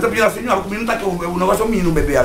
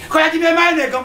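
much higher taunting thug voice; mock provocation; 9 semitones higher than usual. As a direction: taunt like the guy in the back. Guy from the back provokes. (provocação imitada, aguda)

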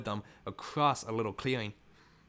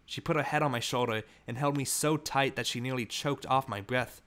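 usual speaking voice

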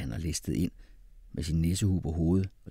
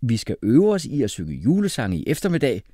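upbeat, louder and higher; a happy, lifted announcement; cheerful; 5 semitones higher, 7 dB louder than usual. A narrator's reading. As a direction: announce it gladly. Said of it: "sagde han glad".

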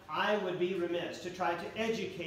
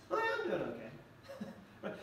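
self-assured aside; 5 dB quieter, slower than usual. Retold or imitated. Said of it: "we're telling ourselves".

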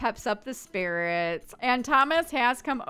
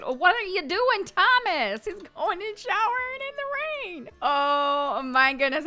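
shocked surprise; 8 semitones higher than usual. Real, not acted. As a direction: react as if catching someone streaking. Sim runs naked into rain.

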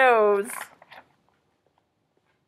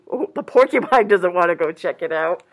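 ordinary delivery

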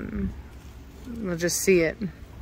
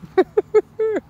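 high laugh; 11 semitones higher, 3 dB louder than usual. Real, not acted. Laughing at the puppy.

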